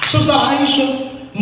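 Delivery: ordinary delivery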